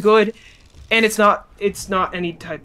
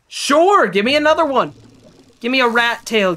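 excited begging; 5 semitones higher, 5 dB louder than usual. Real, not acted. Begging the game for rat tails.

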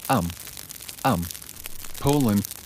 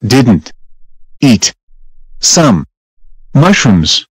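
loud, spaced-out, one word at a time; slow angry denial; 2 semitones lower, 11 dB louder, much slower than usual. Acted. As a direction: hammer each word out slowly.

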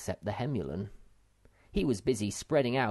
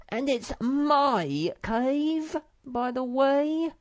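"my" stretched high and slow; possessive stress on my; 5 dB louder, much slower than usual. Acted. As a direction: insist the cave is his. Sniff claiming the cave.

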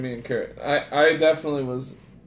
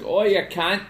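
put-on Australian accent; 5 semitones higher, 3 dB louder than usual. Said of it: "Australian, like".

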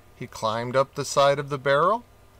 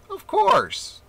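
high, lilting yes; king grants the monkey's wish, high and kindly; bright agreement; 3 dB louder than usual. A narrator's reading.

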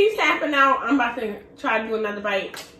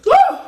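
loud high whoop from the burn; high woo; 11 dB louder than usual. Real, not acted. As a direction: whoop at the heat. Reacting to spicy noodles.

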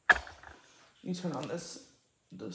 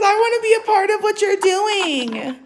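higher, louder put-on cat voice; voicing the cat; 6 dB louder than usual. Owner voicing her cat who wants in on the video, high and loud. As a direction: speak for the pet as if it could talk.

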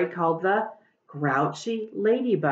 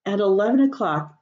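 ordinary delivery

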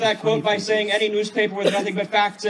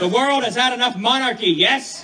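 louder, higher rallying cry; loud soapbox ranting; 5 semitones higher, 5 dB louder than usual. Acted. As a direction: whip up the crowd. Rallying crowd, call and response.

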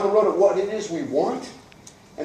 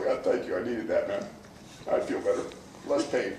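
soft grateful imitation; 10 semitones lower, 8 dB quieter than usual. Hugged phlegmatic thanks you.

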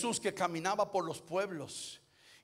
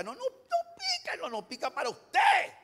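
very high hammed-up Oaxacan lilt, loud finish; Oaxacan sing-song; 11 semitones higher, 5 dB louder than usual. Oaxacan hosts insisting the chile is mild, exaggerated Oaxacan sing-song. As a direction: imitate Oaxacan locals, squeal high then punch the end. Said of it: "los mexicanos de allá de Oaxaca".

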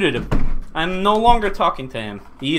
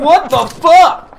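mock shock; 6 semitones higher, 7 dB louder, much slower than usual. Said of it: "I'm like".

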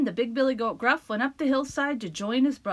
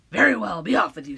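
troll voice; 4 semitones lower, 7 dB louder, faster than usual. Acted. Troll dismisses.

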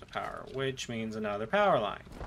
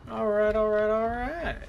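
excited; 9 semitones higher, 5 dB louder than usual. Real, not acted. Excited at automating computers.

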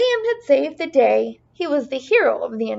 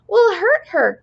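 high, loud worried cry of We'll hurt her; 8 semitones higher, 5 dB louder than usual. Acted. Leprechaun fearing for the stuck unicorn, worried high cry. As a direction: cry it out in alarm for the trapped unicorn. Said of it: "cried a fourth leprechaun".

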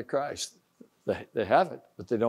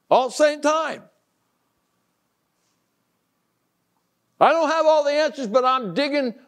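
raised, driving preacher's exhortation; preaching at full force; 10 semitones higher, 6 dB louder than usual. Acted. Preacher exhorting his listeners, loud and insistent. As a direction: push it home like a revival sermon.

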